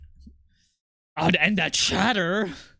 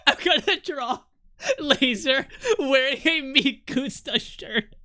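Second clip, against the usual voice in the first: laughing; 7 semitones higher, slower than usual. Real, not acted. Laughing at own joke.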